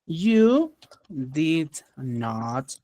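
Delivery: every word stretched out a long time; very slow model sentence. Shown as a demonstration. Teacher dictating the example to students, very slow. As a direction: drag each word out for the class. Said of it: "Fácil".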